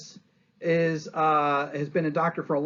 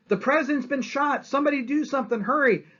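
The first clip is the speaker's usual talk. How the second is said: much higher, urgent alarm; panicked cry for help; 11 semitones higher, 4 dB louder than usual. Retold or imitated. Bystander on the platform crying for help, mock panic. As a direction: call out in a rush of mock panic.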